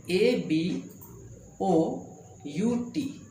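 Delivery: usual speaking voice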